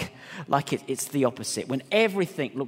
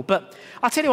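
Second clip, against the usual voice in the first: ordinary delivery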